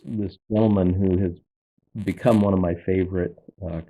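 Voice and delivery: usual speaking voice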